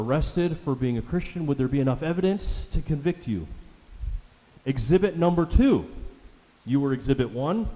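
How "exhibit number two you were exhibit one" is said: courtroom call. Acted. Courtroom announcement in sermon.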